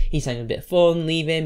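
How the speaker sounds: usual speaking voice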